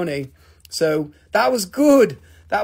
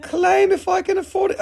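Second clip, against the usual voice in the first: mock scream; 12 semitones higher than usual. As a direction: shriek it like the excited viewer. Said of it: "she's screaming".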